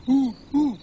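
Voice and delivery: imitated owl hoot. Shown as a demonstration. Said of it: "Owl goes".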